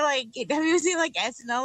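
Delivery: ordinary delivery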